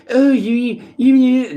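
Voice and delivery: high, loud, whiny nagging; mock whining from taunting voices. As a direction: whine at him like a scolding chorus. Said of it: "They start fucking whining".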